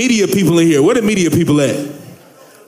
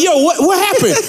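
alarmed question; 10 semitones higher than usual. Retold or imitated. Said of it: "you ask them, like".

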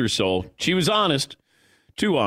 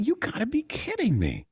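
higher, softer mock disbelief; retold disbelief; 9 semitones higher, 4 dB quieter than usual. Son reacts to his mom's ranking, mock stunned. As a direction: act out stunned disbelief lightly. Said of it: "And I went".

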